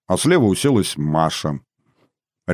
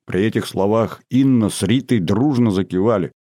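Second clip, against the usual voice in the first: usual speaking voice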